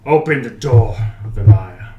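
loud, commanding regal demand; booming warrior king; deep regal voice. Acted. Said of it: "comes a deep, fairly regal voice".

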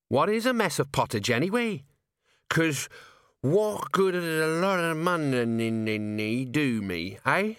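put-on slow, slurred drunken musing; drunk Nobby. A narrator's reading. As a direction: lean on the words like a tipsy philosopher.

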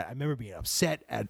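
ordinary talk

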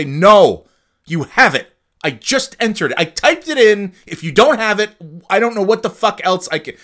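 high, loud angry yell at the bank; yelling at a phone rep who keeps asking; retold furious yell. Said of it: "I would scream".

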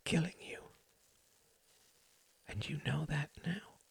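soft, breathy-free hush, barely voiced; gentle whisper. A narrator's reading. A son whispering a hard truth to his mother. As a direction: whisper it gently like the slightest wind. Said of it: "His whisper was as gentle".

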